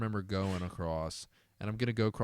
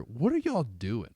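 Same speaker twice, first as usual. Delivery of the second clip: casual cop; 4 semitones higher, 6 dB louder, slower than usual. Retold or imitated. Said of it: "Dude said in the most casual voice".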